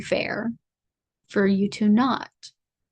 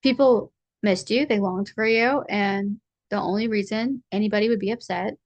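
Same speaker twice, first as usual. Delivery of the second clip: ordinary delivery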